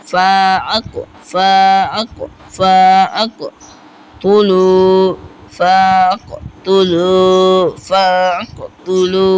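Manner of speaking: long-held "ফা" before the catch in "ফাআক"; recited "ফাআক, তুলু" with prolonged vowels; three-alif elongation. Shown as a demonstration. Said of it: "তিন আলিফ পরিমাণ টান হবে".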